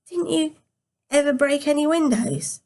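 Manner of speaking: put-on a small boy asking, a bit disappointed; disappointed young grandson. A narrator's reading. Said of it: "He sounded a little disappointed".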